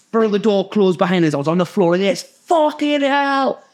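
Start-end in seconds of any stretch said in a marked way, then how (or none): 2.0-3.6 long, drawn-out high wail; hammed-up cry of despair; mock distraught cry. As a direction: stretch it out high like a man at his wits' end. Collapsed on floor after chase.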